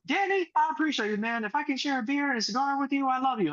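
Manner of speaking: high, effusive imitation of a grateful buddy; high, hammed-up gushing thanks; gushing investor. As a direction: gush thanks like an overfond client.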